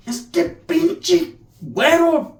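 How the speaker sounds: high, slow strained Spanish exclamation; imitated paisa. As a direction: draw it out high as an exasperated paisa. Imitating the paisa being shaken off.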